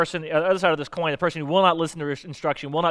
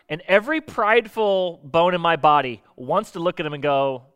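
ordinary talk

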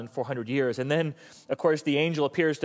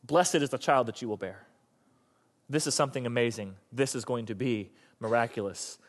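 lower, softer, soothing reassurance; calming assurance; 6 semitones lower, 4 dB quieter than usual. Acted. Preacher as Elizabeth reassuring freaked-out Mary, calming assurance. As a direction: settle her nerves with gentle certainty. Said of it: "a knowledge that almost calms and soothes".

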